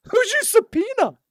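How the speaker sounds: very high, loud, drawn-out question; incredulous demand. Retold or imitated. Teller challenging the agent over the subpoena, incredulous. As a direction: squeak it out in mock disbelief.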